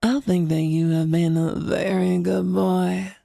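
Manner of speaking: low slow mothering praise; a hint of doting mommy. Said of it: "say it this way".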